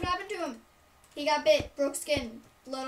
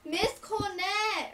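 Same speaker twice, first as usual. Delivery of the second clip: mock yell for the teacher, drawn out; higher, slow calling-out; retold scream; 6 semitones higher, 3 dB louder, much slower than usual. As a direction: imitate a kid hollering for teacher. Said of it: "and so he screamed".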